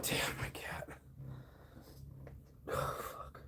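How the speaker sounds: under-the-breath whisper. Real, not acted. Grumbling at his cat, a whisper. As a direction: breathe it without voice. Muttered aside about the cat.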